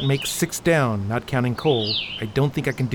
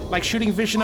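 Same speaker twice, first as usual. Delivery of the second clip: ordinary delivery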